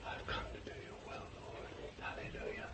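soft, breathy whisper of devotion; whispered vow. Acted. A believer vowing to God in an intimate whisper. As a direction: breathe it like a secret prayer.

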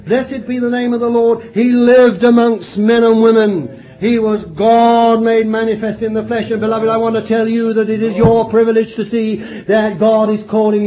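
building pulpit fervour; full-force preaching. Acted. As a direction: preach it out to the congregation.

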